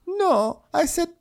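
very high squeaky caricature; mock Diana. Podcaster caricaturing Diana's pencil charity, very high. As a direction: screech pencil like a cartoon. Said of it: "And she's like".

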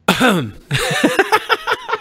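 high giggle after a joke; laughing. Real, not acted. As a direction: laugh at your own joke.

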